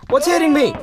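high, loud panicked questions; panicked questioning. Real, not acted. Player shot by unseen enemy, panicked. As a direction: yelp it out under fire. Under fire in game.

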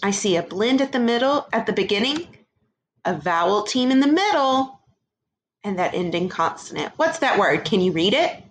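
normal talking voice

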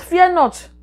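high, loud Fear not; loud proclamation. Real, not acted. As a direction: cry it out to the listeners. Preacher proclaims scripture to congregation.

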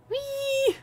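very high gleeful squeal; joyful whee. Real, not acted. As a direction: squeal like on a slide. Riding down happily.

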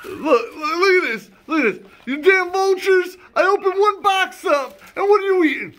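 mock outrage. Real, not acted. Scolding vultures eating candy.

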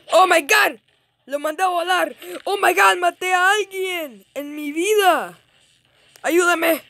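very high, loud excited outburst; thrilled shouting. Real, not acted. Gamer amazed at getting a kill, shouting excitedly. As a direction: shout in amazed triumph, pitch way up.

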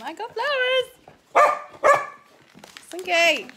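loud high excited call; excited loud exclaim. Real, not acted. Getting flowers and calling the dog, loud high excitement. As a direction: burst out loudly.

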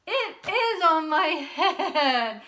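slow, loud, laughing through words. Pretend. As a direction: giggle at your own silliness. Laughs at herself.

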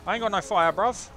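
high, loud protest; alarmed shout. Real, not acted. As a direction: yell it in a comic panic. Panics in the hunt.

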